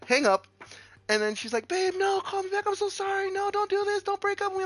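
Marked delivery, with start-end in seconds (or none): 1.7-4.6 put-on high, desperate begging girlfriend; pleading girlfriend voice. Girlfriend begging him to call back, high pleading voice. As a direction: beg him not to leave, voice way up.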